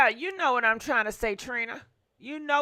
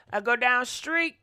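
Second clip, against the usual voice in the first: usual speaking voice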